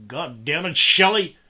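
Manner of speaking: furious father yelling his daughter's name; angry yell. A narrator's reading. Father yelling at daughter who grabbed rifle, furious shout. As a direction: bellow it at her in rage. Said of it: "he yelled at his daughter".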